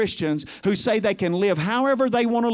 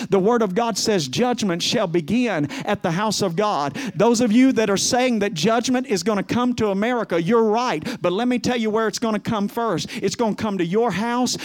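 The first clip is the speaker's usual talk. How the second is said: normal talking voice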